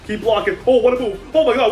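ordinary talk, nothing marked

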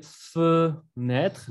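"fenêtre" split as "feu-nètr"; two different Es. Shown as a demonstration. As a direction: separate "fenêtre", rounded first E, open second. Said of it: "la primera E, pues se pronuncia E". ("fenêtre" por sílabas: "feu", luego "nètr")

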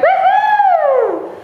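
high, bright whoop for the new year; excited cheer. Real, not acted. Teacher whooping to kids to ring in the year. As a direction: cheer it out like a party.

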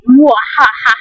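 hammed-up villain cackle; big mwahaha of a cartoon villain; evil laugh. Villain about to attack aliens, evil laugh.

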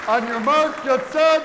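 loud, high starter's call; race starter call. Acted.

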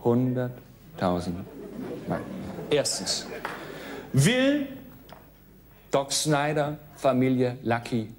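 a hint of a strict teacher; quiz show host. Game show host quiz read.

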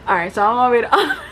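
quick intro with a laugh breaking through; laughing restart of the intro; laughing retake. Shown as a demonstration. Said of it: "Oh, sorry".

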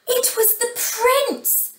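high, slow, astonished; amazed reveal. A narrator's reading. Narrator reveals the messenger's identity, amazed. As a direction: draw out the reveal in high wonder. Big reveal after gasp.

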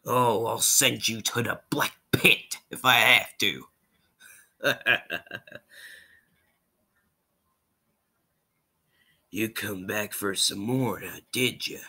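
menacing Southern villain impression; slithery, threatening outlaw voice; Rattlesnake Jake. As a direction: toy with them like a sinister gunslinger. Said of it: "I'm also gonna do Rattlesnake Jake".

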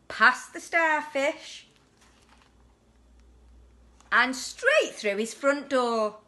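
put-on lively storybook voice for a child; bedtime story reading. A narrator's reading. Parent reading a picture book to children, lively. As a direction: read it like a bedtime picture book to a little one.

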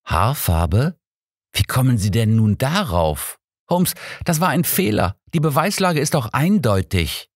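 a hint of Watson's exasperation; scolding. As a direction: grumble at his mistake, not shouting. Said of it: "schimpfte ich".

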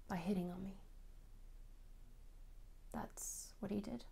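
very soft, near-whispered with long pauses; subdued, hurt. Acted. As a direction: let it drop almost to a whisper. Subdued account of lasting hurt.

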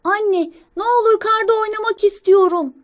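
put-on high, loud pleading child; Çalışkan sincap. Young squirrel begging mother to play in snow, high pleading. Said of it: "Çalışkan sincap".

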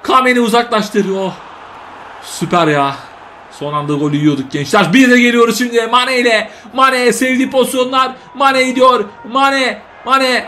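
live play-by-play. Acted. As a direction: call the match like a TV commentator. Mock play-by-play of his own match.

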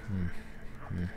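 ordinary delivery

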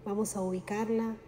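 guided meditation. Acted. Guide leading listeners through breathing and chakra visualization, calm meditation voice. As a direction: guide the listener gently into relaxation, unhurried.

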